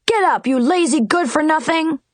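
shouted high wake-up call; yelling. A narrator's reading. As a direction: bark the wake-up at the prisoner. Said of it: "yelled as he struck".

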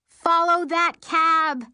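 loud, slow, high shout; screaming. Acted. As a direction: yell it like a movie chase. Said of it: "I scream".

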